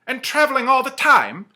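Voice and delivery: high, loud wondering question; lightly voiced Scrooge. Scrooge questions the ghost, high and wondering. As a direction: ask in disbelief.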